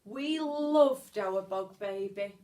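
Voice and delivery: ordinary delivery, nothing marked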